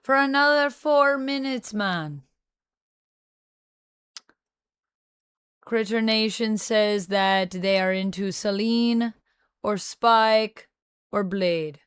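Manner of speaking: put-on Jamaican lilt, not very good; shaky-authenticity Jamaican impersonation; amateur Jamaican accent. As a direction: keep up a rough Jamaican accent. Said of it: "I have to be doing this accent".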